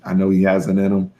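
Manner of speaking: ordinary talk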